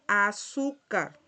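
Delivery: Azúcar drawn out slowly, "a-SU-car". Shown as a demonstration. Teacher shows learners the su sound, slow loud Azúcar. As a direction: stretch Azúcar with a loud start and clear S. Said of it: "se pronuncia como si fuera su".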